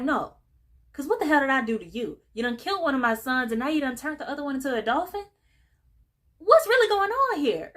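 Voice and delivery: fast, high, outraged rant; outraged father. Retold or imitated. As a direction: rant fast at him, rising to a shriek. Father confronts Oscar in outrage.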